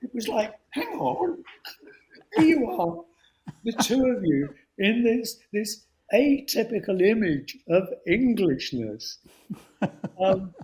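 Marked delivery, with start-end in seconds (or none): 0.7-9.2 awed gushing voice. Retold or imitated. Retelling an awed reaction.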